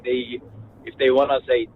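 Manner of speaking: ordinary talk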